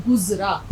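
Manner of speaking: गुज़रा with a clear buzzing z sound; गुज़रा spoken carefully with a true z; careful Urdu z. Shown as a demonstration. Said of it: "आपको इसका तलफ़ुज़ ठीक रखना है".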